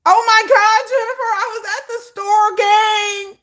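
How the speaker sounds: high loud dramatic put-on voice; mock dramatic vlogger. Mocking Gen Z telling friends, high loud drama. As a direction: shout it like an overexcited vlogger.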